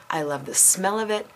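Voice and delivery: usual speaking voice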